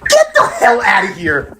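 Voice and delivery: a stunned, laughing shout, drawn out; laughing disbelief. Real, not acted. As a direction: laugh it off as absurd. Disbelief at her salary guess.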